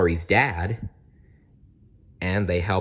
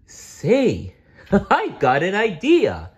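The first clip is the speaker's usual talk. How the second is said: high, laughing playful idea; chuckling Barney; 11 semitones higher, 4 dB louder, slower than usual. Acted. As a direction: giggle with a bright idea. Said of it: "Barney chuckled".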